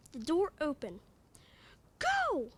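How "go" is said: high, sharp yell. A narrator's reading. Michael yells at the others to get inside. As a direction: shout to send them through. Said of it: "Michael yelled".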